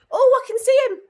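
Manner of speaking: high, loud thrilled call; excited shout. Real, not acted. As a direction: call out with sudden delight. Spots teammate in game.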